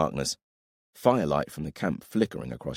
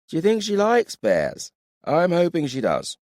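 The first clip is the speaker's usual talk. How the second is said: teasing character voice; 4 dB louder than usual. Acted. Dath teasing Farrell, voiced.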